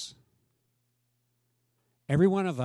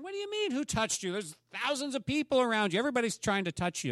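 incredulous disciples; 8 semitones higher, faster than usual. Retold or imitated.

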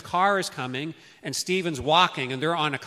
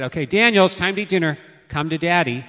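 put-on louder, higher call to a child; father calling his toddler son across the house; 3 semitones higher, 7 dB louder than usual. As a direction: call him to dinner across the room.

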